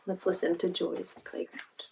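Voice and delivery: ordinary delivery, nothing marked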